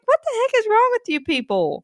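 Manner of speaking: high-pitched incredulous outburst; exasperated. Retold or imitated. Mother answers tactless strangers, high-pitched exasperation. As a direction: squeak it out in exasperated disbelief.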